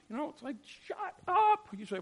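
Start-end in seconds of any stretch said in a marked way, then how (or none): 0.8-1.7 high, loud mock outburst; acted dinner-table yell; mock anger. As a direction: squeal it in exasperation.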